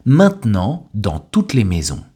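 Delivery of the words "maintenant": "maintenant" as main-t'nant, T kept; silent E. Shown as a demonstration. Teacher gives learners a word to repeat, "maintenant" with silent E. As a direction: model "maintenant" in two syllables without the middle E. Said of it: "Le E de maintenant a complètement disparu".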